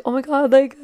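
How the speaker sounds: retold starstruck "oh my God"; high-pitched fan gush, acted out. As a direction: gush like a fan meeting an idol. Fan greeting a favourite TikToker.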